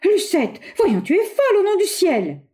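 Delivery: high, urgent remonstrance; alarmed. A narrator's reading. Bois d'Anguin disarms Lucette, urgent and alarmed.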